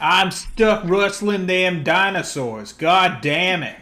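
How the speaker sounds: slow, exasperated Western drawl; hammed-up cowboy complaint; cowboy accent. Villain cursing his own voice, slow cowboy drawl. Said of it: "Why do I talk like this?".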